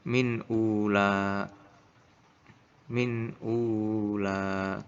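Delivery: "ula" with both vowels held long; drawn-out recitation, lingering on "ula"; long final vowel. Shown as a demonstration. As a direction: recite very slowly, stretching the end of "ula". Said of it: "maka dibaca panjang".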